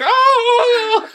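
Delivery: very high mock sobbing wail; mock crying wail. Mom crying harder for her son, mock wail. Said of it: "she just starts crying a little bit harder".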